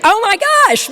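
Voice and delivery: high, loud delighted burst; thrilled exclamation; excited. Real, not acted. Speaker to audience, delighted after the chant.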